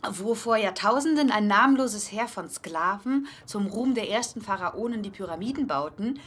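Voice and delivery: reads the article aloud with mock pathos; article reading. (trägt den Artikel mit leichtem Pathos vor)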